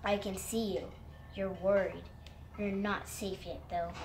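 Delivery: low, quiet watcher from hiding; hushed, low storybook warning; mysterious voice. A narrator's reading. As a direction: drop it low and soft, like an unseen voice. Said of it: "then the voice said".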